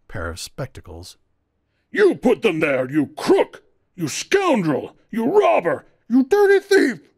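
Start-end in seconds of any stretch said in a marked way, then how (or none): 1.9-5.8 angry accusation. Acted. Furious accuser hurls insults. 6.1-7.1 "You dirty thief!" shrieked high and loud in falsetto; enraged man's voice breaks into a falsetto shriek. A narrator's reading. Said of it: "came out in a high falsetto shriek".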